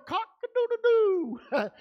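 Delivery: high, drawn-out rooster imitation; long, high-pitched cock-a-doodle-doo; proud rooster crow. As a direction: crow like a strutting rooster, stretching the last note. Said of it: "so he proudly struts around".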